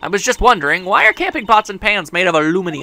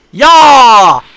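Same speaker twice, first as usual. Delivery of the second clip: loud, high Southern yelling; angry Southern woman; 7 semitones higher, 6 dB louder, slower than usual. Acted. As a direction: scold them with a country twang. Voices angry southern photographer.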